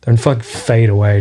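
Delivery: normal talking voice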